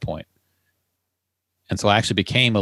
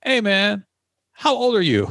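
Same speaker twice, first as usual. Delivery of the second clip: doubting customer; 11 semitones higher than usual. Retold or imitated. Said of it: "pull their car up and be like".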